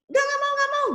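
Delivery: put-on very high, loud child's refusal; acted stubborn kid voice; refusing child. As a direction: refuse repeatedly like a squealing kid.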